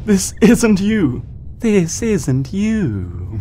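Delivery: high, loud, slow and pleading; desperate plea. Acted. Celestia pleading with her corrupted sister, slow anguished cry. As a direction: drag it out, begging her to remember.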